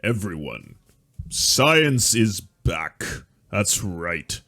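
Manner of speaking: very slow, drawn-out proclamation; a hint of a pompous TV pundit; mock grand announcement. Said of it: "it does sound very Gorka".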